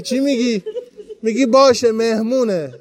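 usual speaking voice

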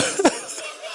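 high breathless sob. Real, not acted. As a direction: break into a sob. Sob breaking through lament.

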